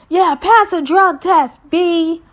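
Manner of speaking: high, loud mock taunt. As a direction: throw a parting jab. [name] taunting departing sister.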